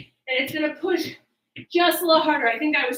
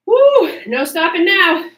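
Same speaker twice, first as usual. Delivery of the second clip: high, loud whoop to pump up; excited cheer; 4 semitones higher, 8 dB louder, slower than usual. Real, not acted. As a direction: whoop it out, then keep pushing. Cheering mid-workout.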